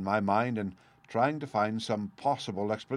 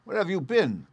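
high-pitched, stern demand; husband's sharp interrogation; stern questioning; 9 semitones higher, 4 dB louder than usual. A narrator's reading. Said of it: "I asked more sternly".

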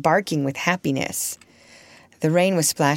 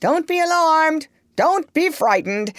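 raised, high-pitched call of reassurance; mild shout; 10 semitones higher than usual. A narrator's reading. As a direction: call out over the noise to calm someone. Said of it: "shout into my ear".

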